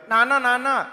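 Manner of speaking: lightly voiced teenage girl; teenage daughter. Teenage girl approaching her father, a hint of girlish voice. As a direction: call out as a young daughter. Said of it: "అమ్మాయి వాళ్ళ నాన్న దగ్గరికి వచ్చి అంటుంది".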